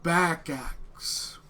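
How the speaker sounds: slow, halting broken speech; slow halting Common from a dragonborn boy; super slow broken Common. Acted. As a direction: spell it out slowly for a foreigner. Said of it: "starts speaking in super slow Common".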